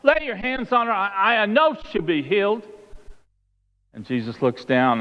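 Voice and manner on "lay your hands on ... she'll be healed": high-pitched, urgent begging, acted out; desperate pleading. Synagogue leader begs Jesus for his daughter, pleading. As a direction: plead as a father for a dying child.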